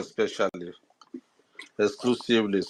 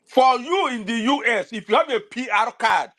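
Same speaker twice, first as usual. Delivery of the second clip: raised, urgent, fast scolding harangue; heated rant; 11 semitones higher than usual. Real, not acted. A speaker on a live space scolding diaspora supporters, heated and high. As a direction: scold the listeners at full pitch, pressing hard.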